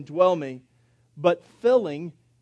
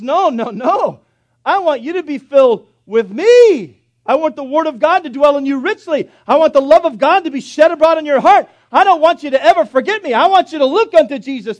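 louder, higher fervent preaching; preaching God's plea; 7 semitones higher, 9 dB louder than usual. Pretend. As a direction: plead earnestly at full force.

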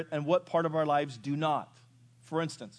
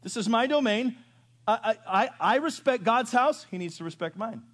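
stubborn homeowner; 7 semitones higher, 6 dB louder than usual. Retold or imitated. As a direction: puff up and claim your turf. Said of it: "the house is their castle".

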